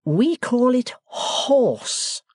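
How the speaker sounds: host correcting his guest; pompous Podsnap. Acted. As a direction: correct him grandly.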